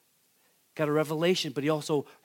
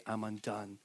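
much softer, lower, broken confession; hushed confession; 6 semitones lower, 12 dB quieter than usual. Retold or imitated. Worshipper falling before the Lord, hushed and low. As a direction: hush it out like a man undone. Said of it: "and you'll say".

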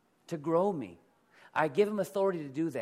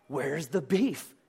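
slow, louder, punchy commercial-line delivery; Wendy's ad quote; 6 dB louder, slower than usual. Retold or imitated. Pastor quoting the Wendy's commercial to his congregation, slow punchy ad line. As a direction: deliver it like the famous ad. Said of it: "that commercial that Wendy's had".

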